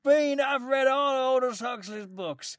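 high, loud, slow mock Southern drawl; mock Southern accent, drunk stranger quizzing him in a pub; drunk Southern American. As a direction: ham up the boozy Colonel asking a question. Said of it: "proper Southern".

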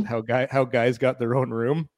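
usual speaking voice

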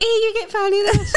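high, laughing schoolkid jeer; mocking playground taunt. Kid taunting a classmate, high laughing jeer. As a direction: tease like a cheeky primary kid.

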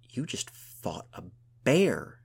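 slow start bursting high and loud on bear; amazed exclamation. A narrator's reading. Gunhild to her friend who fought a bear, exclaiming. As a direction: exclaim it in utter disbelief. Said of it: "she exclaimed".

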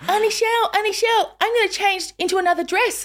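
put-on high little-girl voice; imitating excited niece. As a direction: announce it high like an excited child. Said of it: "And she goes".

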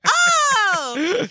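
high squeaky Kirby cries. Retold or imitated. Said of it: "his voice actor would just go".

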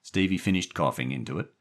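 plain reading voice

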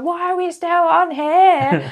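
high, loud retold shout over the wind. Girlfriend shouting at James over howling wind, loud. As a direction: yell it like the wind's howling.

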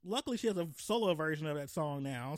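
ordinary delivery

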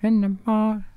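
hammed-up disco falsetto, laughing through it. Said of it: "Sounded like this".

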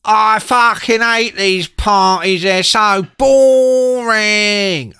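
loud, slow, high contemptuous whine; bored sneer. Retold or imitated. [name] moaning about the party, slow loud sneer. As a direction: drag out the disdain like a spoilt punk.

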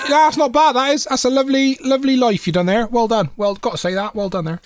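high West Country voice handing out praise; hammed-up West Country congratulations; West Country accent. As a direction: compliment them like a West Country local. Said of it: "West Country is the go-to accent for compliments".

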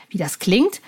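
usual speaking voice

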